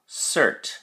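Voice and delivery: cert said alone, stressed and high; stressed syllable alone. Shown as a demonstration. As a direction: isolate cert and lean on it. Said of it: "is on the second syllable".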